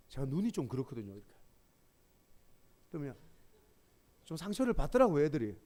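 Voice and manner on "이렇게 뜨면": usual speaking voice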